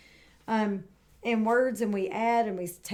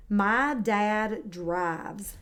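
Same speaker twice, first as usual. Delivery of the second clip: very slow and deliberate, word by word; slow model sentence; much slower than usual. Shown as a demonstration. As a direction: say each word slowly for the child to copy. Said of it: "My turn".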